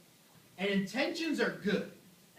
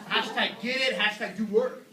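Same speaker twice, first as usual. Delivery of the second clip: louder pumped-up bro chant; gym bro voice; 3 semitones higher, 7 dB louder than usual. Pretend. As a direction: shout it like a hyped gym bro. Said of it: "The guy who talks like this".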